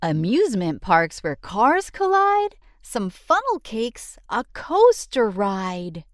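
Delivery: ordinary delivery, nothing marked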